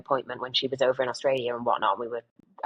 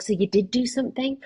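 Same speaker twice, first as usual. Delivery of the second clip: retold surprise; 9 semitones higher than usual. Realising mum tried, retold.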